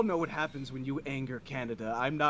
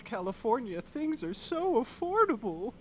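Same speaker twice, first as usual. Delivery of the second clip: sarcastic Southern California jab; 7 semitones higher than usual. Acted. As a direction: pitch it up in mock praise. Sarcastic claim about affordability.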